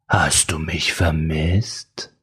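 a dark voice inside her; sinister inner voice. A narrator's reading.

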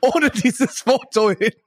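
high, slow, mocking disbelief; slow high sarcastic aside to a friend; incredulous inner voice. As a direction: drag it out, half laughing at the absurdity. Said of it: "Und ich denke mir".

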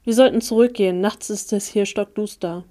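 usual speaking voice

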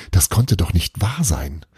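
ordinary delivery

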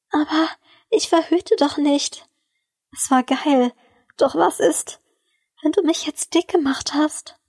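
lightly stammered worry, broken up by pauses; hesitant stammer. A narrator's reading. Woman voicing worry to her lover, hesitant stammer. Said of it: "stammelte sie".

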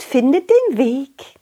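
put-on high girl voice; chirpy little girl; cheerful butterfly girl.

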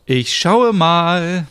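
loud, high and slow; calling out. Acted. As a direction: shout back up from the window. Smilla calls from window.

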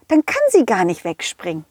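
plain reading voice

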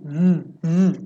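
"v, v" voiced with the tongue on the teeth; buzzing "v, v" like the th in "there"; voiced th demo. Shown as a demonstration. Teacher modeling the voiced th sound. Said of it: "there é o som do v".

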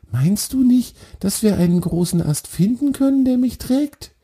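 very high little deer voice, anxious; high and hopeful, a small worried deer; a very high, pleading fawn. Acted.

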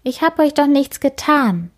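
put-on a small child protesting timidly; hurt little girl's protest; Kim, quietly. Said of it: "sagt Kim leise".

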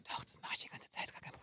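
kaum hörbar geflüstert; Flüstern. Shown as a demonstration. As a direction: ganz leise, tonlos flüstern, um es vorzumachen. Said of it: "nur zu flüstern". (demonstratives Flüstern)

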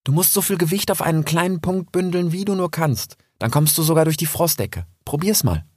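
mother fox teaching her cub to jump through snow. Acted. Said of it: "sagte sie".

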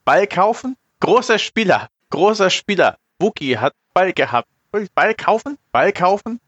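high, pushy Jawa pidgin; squeaky trader voice, broken speech; broken Basic. Acted. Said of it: "als würde ich schlecht Basic sprechen".

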